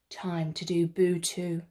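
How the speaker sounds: usual speaking voice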